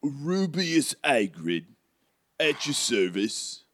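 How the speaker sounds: slow, put-on character introduction; drawn-out storybook character voice; Hagrid. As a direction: introduce yourself slowly in a character accent. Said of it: "Hagrid starts to get up".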